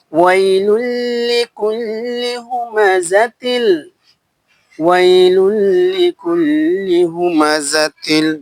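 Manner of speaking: slow, drawn-out Quranic chant; slow tajweed recitation. Shown as a demonstration. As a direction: recite it melodically, stretching the vowels. Teacher recites the verse.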